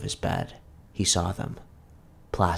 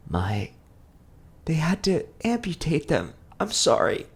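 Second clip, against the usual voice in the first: mother tells her son about his legs; tearful mother; 8 semitones higher than usual. A narrator's reading. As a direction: break the news gently through tears. Mother voiced in story.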